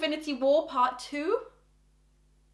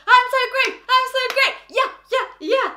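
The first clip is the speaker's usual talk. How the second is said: high, loud hyped-up cheer; over-the-top self-hyping; acted cocky excitement; 11 semitones higher, 7 dB louder than usual. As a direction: chant it giddily, pitched way up. Acting meme of walking in confident.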